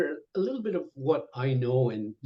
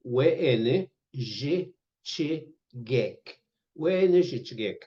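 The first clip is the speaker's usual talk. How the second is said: slow teaching pronunciation; slower than usual. Shown as a demonstration. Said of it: "repeating model word".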